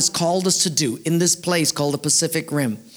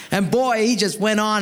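normal talking voice

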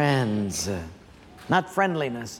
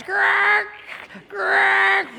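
high, harsh wiper screech; vocal imitation of bare metal grating on glass; imitated metal scraping; 12 semitones higher than usual. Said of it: "all you heard on the driver's side was".

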